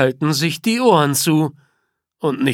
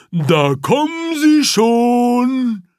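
higher, slow, pleased; lightly voiced gnu; 6 semitones higher, slower than usual. A narrator's reading. Gnu pointing out its arriving friends, higher and pleased. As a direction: announce the herd's arrival.

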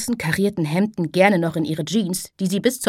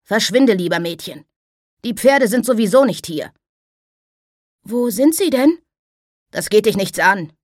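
grim warning; 3 semitones higher, 4 dB louder than usual. Acted. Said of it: "mit grimmiger Miene".

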